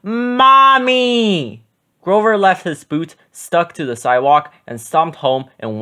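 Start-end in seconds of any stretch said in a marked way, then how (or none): 0.0-1.6 higher, louder cry for mommy; calling out. A narrator's reading. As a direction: wail for mommy.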